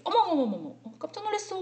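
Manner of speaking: 높은 목소리로 놀라는 시늉. Acted. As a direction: act out a startled, high-pitched gasp of surprise. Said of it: "몸을 움츠려서 갑자기". (움츠리며 깜짝 놀란 척)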